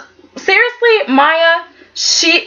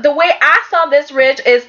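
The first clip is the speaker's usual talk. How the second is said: usual speaking voice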